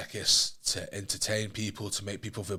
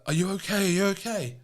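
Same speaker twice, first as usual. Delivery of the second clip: worried mum; 10 semitones higher, 5 dB louder than usual. Retold or imitated. Mum in tears on phone.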